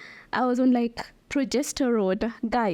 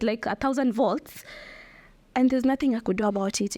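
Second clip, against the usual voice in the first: usual speaking voice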